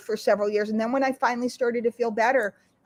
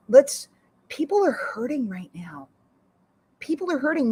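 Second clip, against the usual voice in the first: normal talking voice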